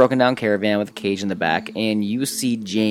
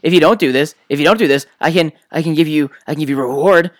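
high, loud frantic pleading; a kobold begging not to be shot; panicked begging; 6 semitones higher, 6 dB louder, faster than usual. Acted. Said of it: "He goes".

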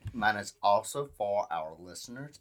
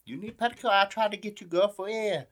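much higher, sing-song put-on saleswoman; high mock shopkeeper voice; 11 semitones higher than usual. Cohost voicing a pushy shopkeeper selling petticoats, high mock accent. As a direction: pitch way up, fussy shop-girl coaxing.

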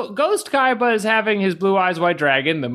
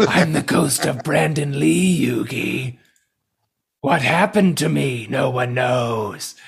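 slow, eerie ghostly drawl; haunt them slowly with each word; spooky ghost voice; 3 semitones lower, slower than usual. Pretend. Voicing Ghost Kaiba as Brandon Lee.

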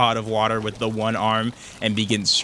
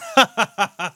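high, loud chuckle; amused chuckle; 10 semitones higher, 5 dB louder than usual. Acted. Nolan greeting Sarah, a high bright chuckle. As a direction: laugh it off like it's nothing. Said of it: "he smiles as he looks at Sarah and goes".

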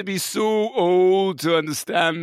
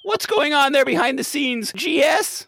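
frantic caller; 8 semitones higher than usual. Pretend.

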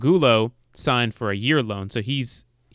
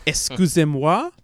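put-on Excusez-moi slow and rising, heavy American accent; Excusez-moi in clumsy American-accented French; 4 semitones higher than usual. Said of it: "sounds the most American speaking it".

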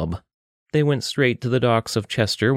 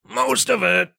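high nasal-free gruff friend; 14 semitones higher, slower than usual. Acted. Richter calls to Saladia from behind, high put-on voice. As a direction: call out from behind her as Richter.

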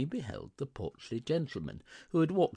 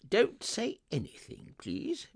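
high, insistent pleading; retort; 13 semitones higher than usual. A narrator's reading. Scrooge refusing the gentleman's thanks, insistent. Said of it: "retorted Scrooge".

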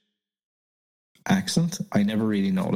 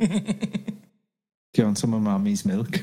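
slow, teasing mock-mother offer; slow put-on mommy voice; much slower than usual. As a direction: play a mommy offering milk, drawn out. Playing the wife offering milk.

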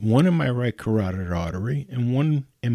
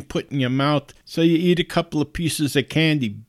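ordinary talk

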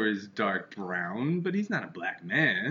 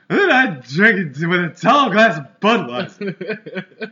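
put-on slow, high, loud laughing old comic voice; Ed Wynn voice; 7 semitones higher, 12 dB louder, much slower than usual. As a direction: draw it out like a giggly old comedian. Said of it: "That was more Ed Wynn".

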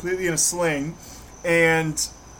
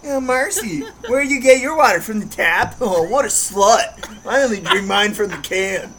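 high, prissy put-on snob; snobby mock voice; 3 semitones higher, 4 dB louder than usual. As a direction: sneer at tap water drinkers. Mocking snob about water.

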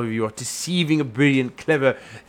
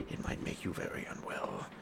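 a soft unvoiced whisper; 18 dB quieter than usual. Whispering an ominous warning to the friend over muffins.

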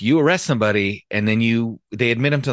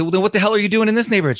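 high, fast burst of disbelief; incredulous cop; 13 semitones higher, 6 dB louder, much faster than usual. Retold or imitated. Cop to girl he once helped, high fast disbelief. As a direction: fire it off fast, way up high, can't believe it.

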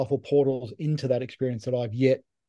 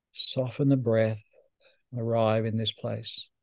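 guided meditation; 2 semitones lower, 4 dB quieter than usual. Acted. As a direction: gently lead them inward, phrase by phrase, leaving space to breathe. Leads a guided heart meditation.

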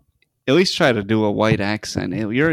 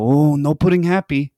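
imitated Dominican accent, higher and louder; Dominican coworker; 5 semitones higher, 4 dB louder than usual. Mimicking an upset coworker at work, Dominican accent. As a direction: put on upset Dominican broken English. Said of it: "this is how he talks".

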